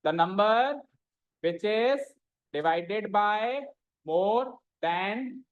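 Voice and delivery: slow chunks, each left rising; dictation. Shown as a demonstration. Teacher dictating a definition to students, piece by piece. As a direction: pause after each phrase so they can write.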